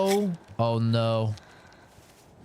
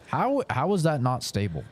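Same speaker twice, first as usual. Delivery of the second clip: ordinary talk, nothing marked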